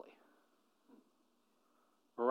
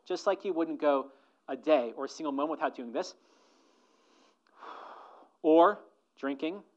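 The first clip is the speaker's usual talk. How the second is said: ordinary delivery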